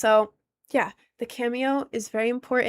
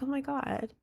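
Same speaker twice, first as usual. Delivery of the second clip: grateful gush; 3 semitones higher, 4 dB quieter than usual. Retold or imitated. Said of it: "It's like".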